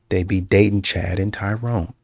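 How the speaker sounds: ordinary talk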